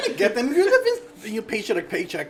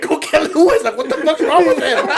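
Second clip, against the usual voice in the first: loud, higher mock call with a laugh; manager calling out; 8 semitones higher, 10 dB louder than usual. Coworkers sending someone after him, loud laughing mock call. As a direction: call for him like a baffled boss. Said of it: "They're like".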